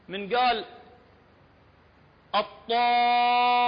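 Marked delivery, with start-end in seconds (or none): 2.3-3.6 الطامة with heavy ط and very long held aa; loud sustained recitation of الطامة on one note; heavy long madd. Shown as a demonstration. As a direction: chant الطامة loudly, stretch the vowel, double the م. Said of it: "وخلى مد لازم كلمي مثقل".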